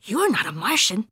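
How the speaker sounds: quick, lively contradiction; exclaiming kitty boy. A narrator's reading. Skipito challenging the Martian, exclaiming. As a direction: say it with a swooping tune.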